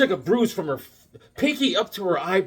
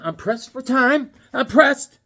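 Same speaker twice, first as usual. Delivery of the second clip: higher, slower, louder each time; rising urgency; 7 semitones higher, 3 dB louder, slower than usual. Real, not acted. Hurried host stressing he's short on time, more urgent. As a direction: press the point home, more urgent. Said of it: "repeats line, building".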